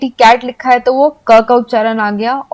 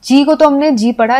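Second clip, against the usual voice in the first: ordinary delivery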